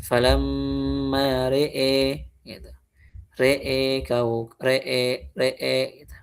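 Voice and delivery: re'e drawn out, ending on long e; imalah at pause. Shown as a demonstration. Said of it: "Gimana bacanya?".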